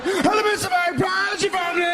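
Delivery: fast, high cheerful shout; cheery greeting. Retold or imitated. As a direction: call out brightly to the magpie. Cheerfully greeting a magpie while driving.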